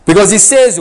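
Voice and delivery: usual speaking voice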